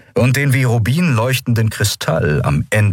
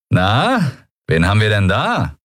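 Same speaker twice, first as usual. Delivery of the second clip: put-on lilting, amused taunt of a young man; 2 semitones higher than usual. A narrator's reading. Young pirate surprising two intruders, amused. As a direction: ask it like a pleased captor. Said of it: "unüberhörbar amüsiert".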